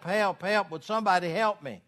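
a half-hearted retold scream; mock scream. Man stuck on a cliff calls for help, mild mock scream. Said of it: "And he screamed".

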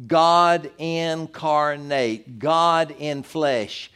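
very slow, weighty "God incarnate, God in flesh"; solemn drawn-out declaration; slow emphasis. Acted. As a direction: let each word land slowly. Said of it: "slowed emphasis on doctrine".

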